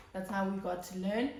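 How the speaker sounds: normal talking voice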